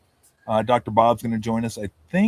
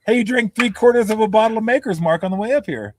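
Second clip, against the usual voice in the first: higher, louder mock confront; teasing accusation; 9 semitones higher, 6 dB louder than usual. Host confronting Davey about the bourbon. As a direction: call him out playfully. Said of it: "confronting Davey".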